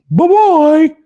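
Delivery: high, loud, singsong taunt; mocking bye-bye. Host mocks Freeman dumping the team with a loud taunting goodbye. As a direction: wave him off mockingly.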